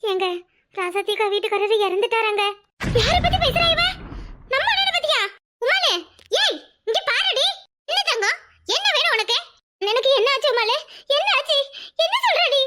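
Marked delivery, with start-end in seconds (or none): 3.0-12.6 much higher, alarmed questioning; startled, voice jumping up in shock; shocked disbelief. Acted. To her sister-in-law, a high shocked protest.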